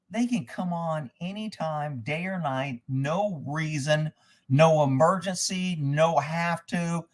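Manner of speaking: slow, measured exasperation, item by item; slow, emphatic listing. Real, not acted. Landowner spelling out what the electric company can do, slow and pointed. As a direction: drag out each item.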